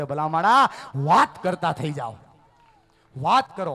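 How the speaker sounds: loud, driving sermon voice, hammering each line; preaching at full force. Acted. Preacher urging the assembly to keep talking, loud and fervent. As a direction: preach it to the back row.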